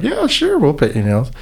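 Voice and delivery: very high, obliging reply; imitated eager agreement. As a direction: voice an accommodating volunteer. Volunteers cheerfully agreeing, acted out.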